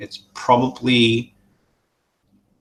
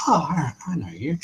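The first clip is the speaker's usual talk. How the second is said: a hushed, higher whisper of recognition; a secretive whispered greeting; 8 semitones higher than usual. Acted. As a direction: lean in and breathe it as if you've spotted an old face. GM voices tavern keeper recognizing player.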